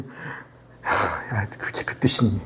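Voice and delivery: low, hushed, airy disbelief; exasperated parent. Acted. Mother to child who took her threat literally, hushed exasperation. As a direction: sigh the scolding out very softly.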